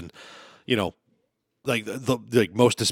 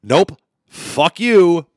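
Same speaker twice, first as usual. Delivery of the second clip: slow, loud and defiant; 6 semitones higher, 6 dB louder, much slower than usual. Retold or imitated. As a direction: spit it out slowly at him.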